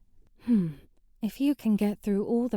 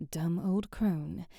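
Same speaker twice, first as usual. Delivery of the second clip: softer, slow grumble under the breath; muttered aside; 5 semitones lower, 6 dB quieter, slower than usual. Acted. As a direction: mutter it to yourself. Grumbling aside about the witch.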